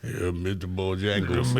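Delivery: lightly voiced, drawn-out film character; film character impression. Host riffs on the Green Mile, film character impression. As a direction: put on a slow movie-character voice.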